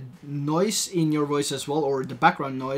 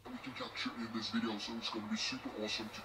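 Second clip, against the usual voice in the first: lowered pitch effect, unnatural timbre; deep pitch shifted; 4 semitones lower, 16 dB quieter, much faster than usual. Shown as a demonstration. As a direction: voice pitched down electronically. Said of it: "So there we have the deep voice".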